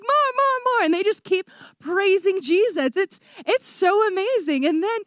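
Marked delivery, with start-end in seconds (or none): 0.0-0.8 put-on high, insistent kid chant; eager children. Kids refusing to stop praising, eager begging. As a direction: imitate excited children begging, pitched way up.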